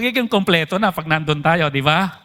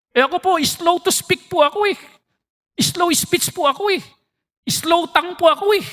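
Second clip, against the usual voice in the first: Moses begging off; 8 semitones higher than usual. Retold or imitated. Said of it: "Sabi niyo".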